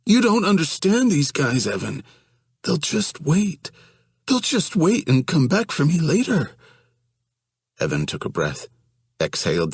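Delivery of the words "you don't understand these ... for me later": high, panicky, pleading voice; whiny scared young man. Acted. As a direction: voice him as a frightened, whining guy. Johnny pleads with Evan.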